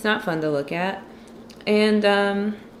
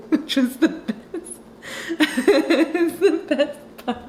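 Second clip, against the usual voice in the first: words squeezed through high laughter; laughing while talking; 7 semitones higher, slower than usual. Real, not acted. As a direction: giggle through the line, slowly. Laughing at her own imitation.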